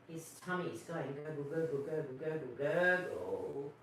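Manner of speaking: Santa's tummy as reindeer pull, low gurgling; low gurgle. Acted. Imitating tummy noise.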